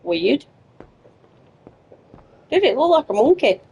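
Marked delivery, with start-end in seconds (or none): 2.5-3.6 incredulous. Real, not acted. Asking in disbelief.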